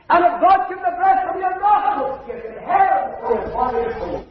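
high, loud pleading appeal; impassioned preaching. Acted. Preacher pleading with a young girl in the meeting, impassioned loud appeal. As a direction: plead hard with the unsaved listener.

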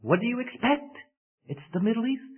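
high, soft, matter-of-fact punchline; shrugging scorpion. Acted. The scorpion answers the drowning crocodile with a high, offhand shrug. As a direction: toss it off like it's obvious.

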